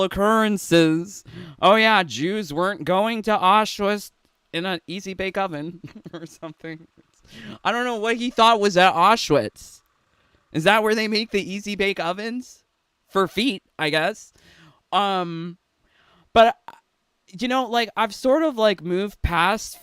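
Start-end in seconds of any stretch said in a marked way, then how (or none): none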